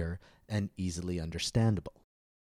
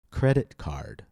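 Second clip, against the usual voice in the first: "Credit card" with CREDIT high, card dropping; stress on first noun; 5 semitones higher, 4 dB louder, slower than usual. Shown as a demonstration. Teacher leading listen and repeat, "Credit card" stressed on CREDIT. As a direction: lift "Credit", drop "card". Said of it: "Stress the first noun".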